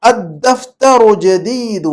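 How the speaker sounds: very slow, drawn-out Arabic recitation; model reading. Shown as a demonstration. Teacher reading a sentence for translation, very slow model reading. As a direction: read it out measured, stretching long vowels. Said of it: "جملہ پڑھتا ہوں".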